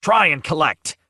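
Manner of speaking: high, loud defiant shout; angry cry. Acted. C shouting at Ridolf while restrained, loud and angry. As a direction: hurl it at him. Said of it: "C cried out".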